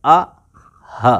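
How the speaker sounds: आ, then हा from the back of the throat; tajweed sound demo. Shown as a demonstration. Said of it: "तो इसको कहते हैं अक्साए हलक".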